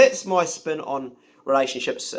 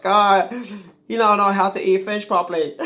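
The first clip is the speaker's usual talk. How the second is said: imitated Chinese accent, a bit higher; mock Chinese accent; 4 semitones higher than usual. Man imitating his partner scolding him over fish, mock Chinese accent. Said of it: "That's a Chinese accent".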